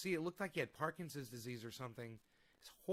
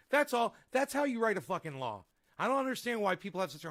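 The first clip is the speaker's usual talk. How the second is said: louder, higher burst of exasperated ranting; heated outburst; 6 semitones higher, 7 dB louder than usual. Real, not acted. Streamer ranting to viewers about lax prison laws, heated outburst. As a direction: blurt it out hard and loud, fed up.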